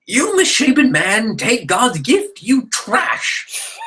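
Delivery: high, slow, drawn-out scolding voice; old woman. A narrator's reading. Old woman to the misshapen bridegroom, high scornful call. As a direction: hurl the insult slowly, pitched well up. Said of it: "accompanied by an old woman".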